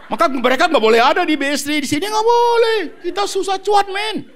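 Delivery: rising from fast laughing talk to a high shout; building to a shout. Real, not acted. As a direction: build it up until you're shouting the no.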